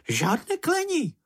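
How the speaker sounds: very high-pitched little animal reproof; high creature scolding. A narrator's reading. Creature warns friend against swearing, high-pitched scolding.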